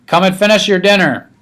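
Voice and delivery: calling. A narrator's reading. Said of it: "my father called".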